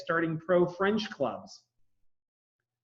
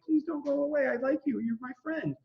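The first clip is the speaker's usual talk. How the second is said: pleading Adams; 11 semitones higher than usual. Retold or imitated. Adams begging Jefferson to stay, high pleading voice. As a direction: plead like a clingy friend not wanting him to leave.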